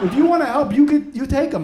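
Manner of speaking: loud, high exasperated rant; fed-up outburst. Acted. Parent handing toddler over to waitress, fed up. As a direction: throw the offer out in mock desperation.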